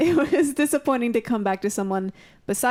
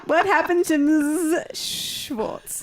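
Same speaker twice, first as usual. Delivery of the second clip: drawn-out slow question; 6 semitones higher, much slower than usual. Acted. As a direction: ask it slowly. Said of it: "Without intending to".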